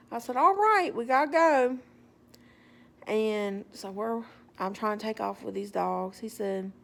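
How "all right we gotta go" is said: higher, louder push to get away; loud, lifted wrap-it-up; insistent brush-off. Retold or imitated. Walker trying to take off with dogs, insistent brush-off.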